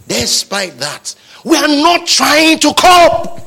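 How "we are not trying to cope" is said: high, loud, slow declaration; forceful preaching. Real, not acted. Preacher rejecting mere coping, forceful declaration. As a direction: proclaim it slowly at raised pitch.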